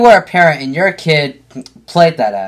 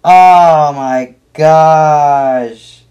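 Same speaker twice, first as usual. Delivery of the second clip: shocked exclamation; 3 semitones higher, 4 dB louder, much slower than usual. Real, not acted. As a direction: blurt out in shock. Reacts to knife threat clip.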